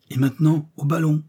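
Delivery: rally them back to the balloon; urgent call. Acted. Doctor urging his companion back to the balloon, rallying.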